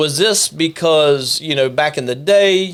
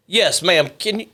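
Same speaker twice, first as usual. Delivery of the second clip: normal talking voice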